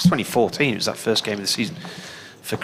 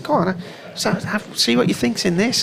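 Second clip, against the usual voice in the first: put-on girl pushing the drink; 10 semitones higher than usual. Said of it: "And she said".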